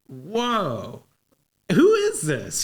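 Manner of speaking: drawn-out whoa, then a high-pitched question; mock astonishment leaping up in pitch; acted amazement. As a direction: play someone suddenly awestruck. Amazed hierarchy reacting to her answers.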